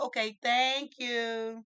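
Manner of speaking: loud, high, drawn-out thank you; showy thanks. Retold or imitated. Mother thanks lady in on the bluff, showy thanks. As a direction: stretch the thanks out for show.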